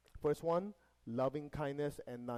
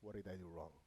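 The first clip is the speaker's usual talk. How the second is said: reflective thinking; 5 semitones lower, 5 dB quieter than usual. Acted. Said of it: "go into meditation and think".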